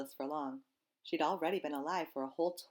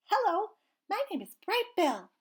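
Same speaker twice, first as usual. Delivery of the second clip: high, louder eager gosling; a small chick proudly introducing himself; little gosling voice; 8 dB louder than usual. Acted. Gosling introduces himself to anyone listening, high and eager.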